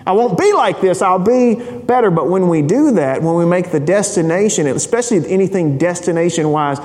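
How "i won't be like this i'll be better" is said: put-on high, eager little boy promising; pleading child Luke. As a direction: promise it like a kid begging. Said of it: "And that was Luke's argument. He's like".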